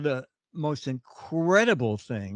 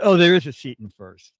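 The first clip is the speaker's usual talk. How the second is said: louder, delighted announcement; excited discovery; 10 dB louder than usual. Retold or imitated. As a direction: tell him the good news with a burst of delight. Gate agent happily finds a seat.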